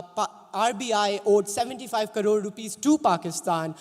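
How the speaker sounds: ordinary talk, nothing marked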